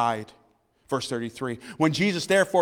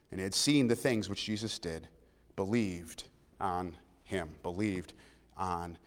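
hushed emphasis; 9 semitones lower, 11 dB quieter than usual. Real, not acted. Hushed close of scripture reading.

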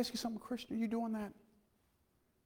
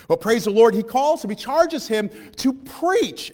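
preaching; 7 semitones higher, 10 dB louder, slower than usual. Acted. Preaching at full force.

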